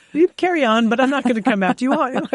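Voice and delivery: mock doorbell voice, high and loud; mock Nest guy voice. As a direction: play the guy hiding behind the doorbell, hammed up.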